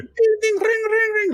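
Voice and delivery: very high held ding, ding, ring ring on one note; imitated trolley bell. Mocking the conductor's bell, high bell imitation. Mimicking tram bell again.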